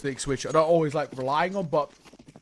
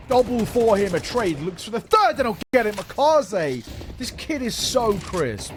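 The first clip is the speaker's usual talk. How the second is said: hyped play-by-play call, louder and higher; esports caster; 6 semitones higher, 4 dB louder, slower than usual. Acted. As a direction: call the triple kill with rising hype.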